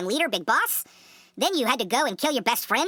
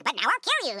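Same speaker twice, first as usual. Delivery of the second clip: mock villain threat, pitched up; hammed-up higher villain voice; Liquid Snake; 5 semitones higher, faster than usual. Podcaster imitating Liquid threatening Snake, high theatrical voice. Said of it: "and then is like".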